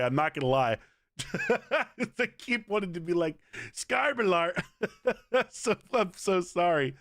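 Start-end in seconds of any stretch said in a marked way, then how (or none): 1.2-2.1 high giggle burst; a quick high laugh. Real, not acted. Streamer cracking up at his old rant. 2.2-6.9 giggling through the words; laughing. Real, not acted. Streamer laughing at his own silly champion name. Laughing while recalling the rant.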